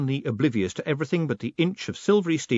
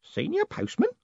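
very high, wondering question; astonished. A narrator's reading. Old man hearing of his promotion, astonished. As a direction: echo the title in disbelief. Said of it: "The old man's eyes widened".

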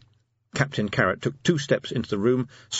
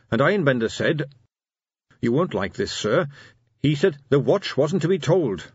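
put-on earnest young officer reporting, voice pitched up; earnest captain Carrot; 6 semitones higher than usual. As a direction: report dutifully to your commander.

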